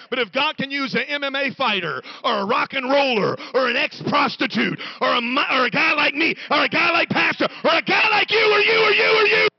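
high, loud climax on the edge of tears; shouting preacher, voice cracking. Acted. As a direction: cry it out to the crowd, building to breaking. Sermon climax.

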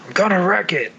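lightly voiced brute catchphrase; Wreck-It Ralph. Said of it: "his ... voice".

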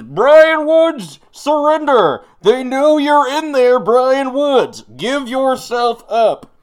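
loud, high imitated megaphone announcement; megaphone yelling. Police calling a fugitive in the woods, megaphone yell. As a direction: yell it stretched out, as if through a megaphone. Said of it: "people yelling over megaphones".

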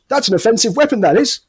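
high, pompous English constable; pompous bobby voice; policeman impression. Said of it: "one of them and goes".

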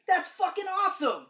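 loud, high burst of awe; fanboy yelling in delight; excited exclamation. Retold or imitated. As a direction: blurt it out, thrilled. Retold excited reaction.

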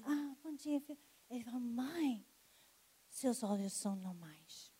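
soft, hushed, almost reverent; near-whispered memory of the morning; hushed retelling. Retold or imitated. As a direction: relive the quiet moment softly. Son wakes her, eyes healed.

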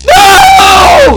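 long, piercing, full-force scream; horrified scream. Acted. Man watching his own execution, screaming. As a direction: scream in utter terror.

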